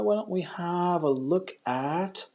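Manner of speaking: slow, drawn-out browsing voice; musing. Real, not acted. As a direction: stretch the words while you scan a list. Browsing the list while choosing.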